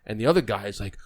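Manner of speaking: normal talking voice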